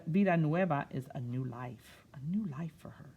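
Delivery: plain reading voice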